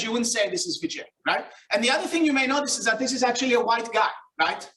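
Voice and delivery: ordinary delivery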